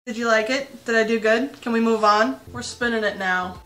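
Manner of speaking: usual speaking voice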